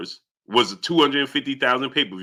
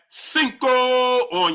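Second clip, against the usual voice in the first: long stretched, held CINCO; celebratory elongated "cinco"; drawn-out shout-out; 10 semitones higher, much slower than usual. Real, not acted. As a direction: hold the last vowel of cinco like an announcer. Celebrates a viewer's donation.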